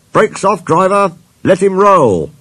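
loud, high-pitched order; commanding call. A narrator's reading. Inspector orders driver to release brakes, commanding.

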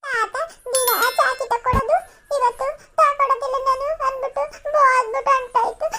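put-on very high, wailing child's grievance; tearful child. Child complains grandfather left him behind, wailing. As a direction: cry it out like a wronged child. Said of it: "ಆಯ್ತು ಕವ, ಅಳ್ಬಾರ್ದು".

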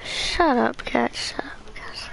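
hissed at the cat under the breath; sharp whispered telling-off; hushed scolding. Real, not acted. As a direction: shush the cat without the mic hearing. Scolding a noisy cat mid-stream.

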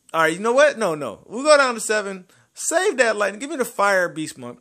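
loud, high eager begging; real hyped begging; excited pleading. Real, not acted. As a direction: pump yourself up for the roll. Begging the game for a summon.

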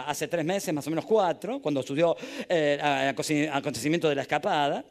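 ordinary delivery

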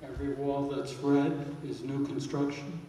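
normal talking voice

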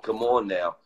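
ordinary talk

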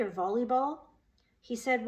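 ordinary delivery

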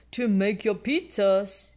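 a hint of a jolly father; Daddy Pig voice. A narrator's reading. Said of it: "Daddy Pig says".

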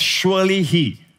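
"Surely he." slow, leaning on "he"; stressed "Surely he". Shown as a demonstration. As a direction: let "he" carry the weight, slowly. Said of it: "And the emphasis is not on the work, but on the person".

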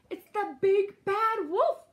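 high, loud, drawn-out warning; alarmed cry. A narrator's reading. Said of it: "he cried".

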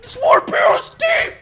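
very high shouted whine; frustrated shriek. Real, not acted. Player upset over team choice, frustrated high shriek. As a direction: shriek it like a tantrum. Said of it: "I'm so mad".

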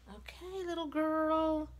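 high, drawn-out coo; cooing baby talk. Pretend. Collector talks to her new doll, cooing. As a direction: coax a baby sweetly.

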